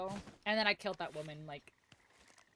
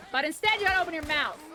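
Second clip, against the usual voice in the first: mock scolding; 6 semitones higher, 5 dB louder, faster than usual. Scolding NPC before shooting.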